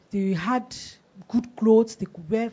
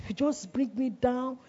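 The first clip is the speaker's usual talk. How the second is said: normal talking voice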